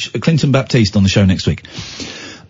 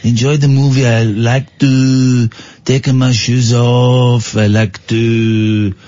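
put-on French accent. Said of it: "in my French accent".

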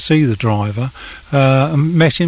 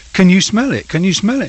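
urgent retold call; 6 semitones higher, faster than usual. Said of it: "I'm saying to Elizabeth".

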